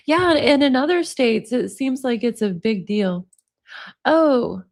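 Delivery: ordinary talk, nothing marked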